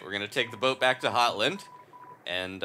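ordinary delivery

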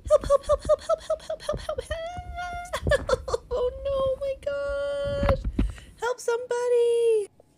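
high crying cries for help; phobic panic. Pretend. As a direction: scream for help, near tears.

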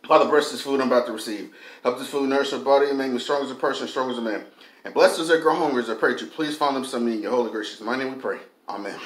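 low, fast murmured grace; quiet prayer. Real, not acted. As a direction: say grace softly and quickly. Prays over his food.

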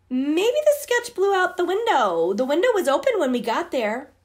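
high, innocent-sounding fib; nervous lie. Acted. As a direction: fake innocence to cover up. Girls lie to Oliver.

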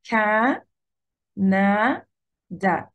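كا، نا، دا with long pauses between; three drawn-out, isolated syllables; split into syllables. Shown as a demonstration. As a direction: sound out each syllable separately. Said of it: "فبقسمها كده".

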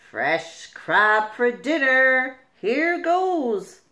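slow, loud hungry predator; greedy fish voice. Acted. Hungry fish eyes the crab, greedy. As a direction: relish the dinner.

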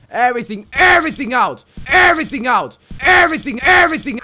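escalating battle-cry chant; building shout. Real, not acted. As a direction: shout it harder each time, then stretch the last one right out. Chanting louder and louder while playing.

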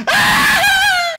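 high, loud fake scream; mock death scream. Gamer screams at enemies on purpose while dying. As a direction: scream on purpose as you die. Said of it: "falar umas coisas estranhas na hora que eu morrer".

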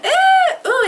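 high, loud surprised ooh; delighted gasp. Real, not acted. Unwrapping a gift, squealing with surprise. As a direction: squeal at the reveal.

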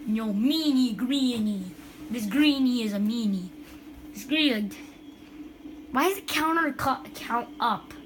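ordinary talk

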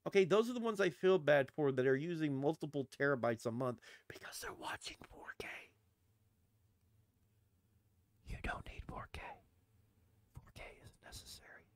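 unvoiced hushed aside; whisper. Real, not acted. Vlogger confiding about 4K streaming, whispered. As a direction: whisper it like a secret.

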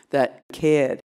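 Boston non-rhotic cared, no R sound; drawn-out Boston cared with the R dropped; Boston accent. Shown as a demonstration. Lingering on the Boston way of saying cared. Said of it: "that's my whole talk right there".

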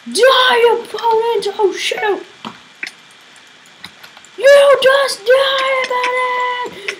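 high, drawn-out cries at an opponent; battle yelling. Real, not acted. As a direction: yell wildly in the heat of the game.

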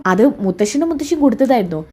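usual speaking voice

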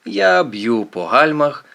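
ordinary delivery, nothing marked

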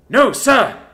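loud, high "No, sir!"; shouted reply. Acted. Scared trainee shouting back at sergeant, loud. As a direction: snap the answer back at full volume.

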